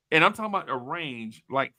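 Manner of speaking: ordinary talk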